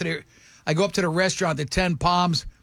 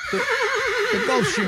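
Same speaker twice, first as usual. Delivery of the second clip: drawn-out high Gulfstream; much slower than usual. Retold or imitated. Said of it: "dramatic drawn-out Gulfstream".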